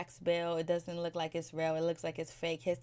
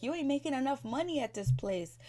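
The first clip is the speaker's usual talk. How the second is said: imitated griping in a higher pitch; mock complaint; 7 semitones higher than usual. Baby daddy griping to Mel about her money, mock complaint. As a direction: complain higher, mimicking an aggrieved partner.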